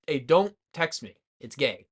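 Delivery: ordinary delivery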